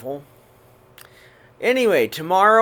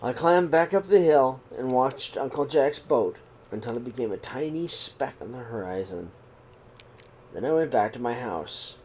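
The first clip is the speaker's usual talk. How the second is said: calm, even read-aloud voice; steady storybook telling; story narration; 4 semitones lower than usual. A narrator's reading. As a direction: read the story gently to the kids. Reads picture book aloud.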